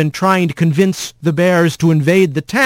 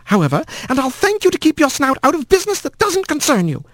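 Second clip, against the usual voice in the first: put-on hateful old aunt; 6 semitones higher than usual. A narrator's reading. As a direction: spit out contempt without raising the voice. Sour aunt Meline voiced.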